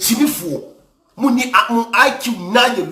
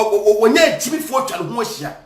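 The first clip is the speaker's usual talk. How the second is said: normal talking voice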